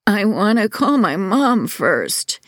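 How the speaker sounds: put-on a hurt boy's croaked request; croaking plea. A narrator's reading. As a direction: plead weakly for his mom. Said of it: "Devon croaked".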